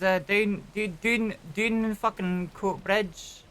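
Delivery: thick Glaswegian hard-man impression; Glasgow accent. Shown as a demonstration. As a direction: talk like a Glasgow ruffian. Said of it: "It's Glasgow as fuck".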